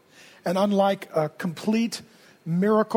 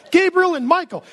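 ordinary talk

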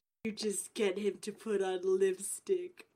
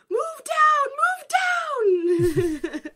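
high, hammed-up tea party holler; Mad Hatter's tea party shout; 10 dB louder, much slower than usual. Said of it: "they're like".

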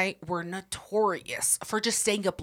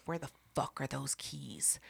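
creepy whisper; 6 semitones lower, 14 dB quieter than usual. Retold or imitated. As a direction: hiss it like a ghost in the dark. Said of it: "she turns her neck slowly and she goes".

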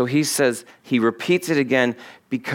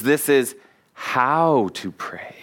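hushed slow whisper; 2 semitones lower, slower than usual. Shown as a demonstration. Preacher whispering the true way to pray to the congregation, slow whisper. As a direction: breathe it out slowly, barely voiced. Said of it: "Big difference".

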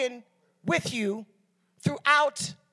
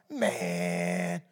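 a much lower, groaning "Man"; mock moan. Preacher imitates his brother moaning over trial pastors. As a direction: sink into a hammed-up lament.

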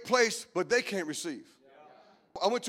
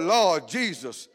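ordinary talk, nothing marked

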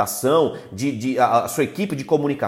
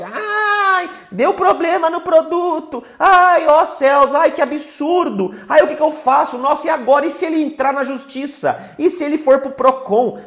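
tragic heroine sorrow; 11 semitones higher, 4 dB louder than usual. Pretend. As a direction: wail like a helpless drama queen. Mocks a panicking salesperson. (lamento chorão e dramático, bem agudo)

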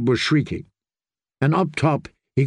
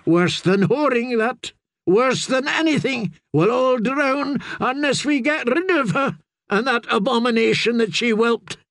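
higher, rough sailor voice, resentful; superstitious sailor; 11 semitones higher than usual. A narrator's reading. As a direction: spit it out like a fearful deckhand. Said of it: "crew muttering".

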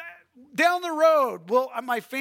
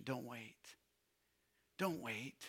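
soft, low urgent plea; hushed plea; 9 semitones lower, 17 dB quieter than usual. Real, not acted. Preacher pleading softly with the congregation not to wait. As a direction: drop right down and plead.

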